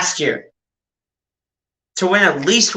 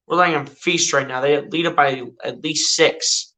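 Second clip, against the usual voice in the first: ordinary delivery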